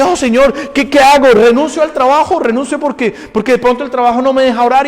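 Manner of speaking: high, loud, rushing plea to the Lord; breathless, overexcited prayer; eager pleading believer. Retold or imitated. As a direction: cry out like a zealous new convert. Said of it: "uno empieza a vivir intensamente para Dios y dice".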